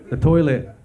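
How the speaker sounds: ordinary delivery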